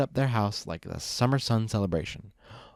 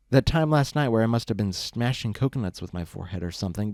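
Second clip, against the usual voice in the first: ordinary delivery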